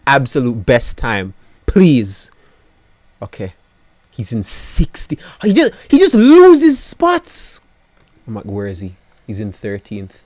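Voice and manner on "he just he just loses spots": much higher and louder, slow, whining; anguished wail. Real, not acted. Team boss watching his driver lose places, high slow wail. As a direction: cry out in despair.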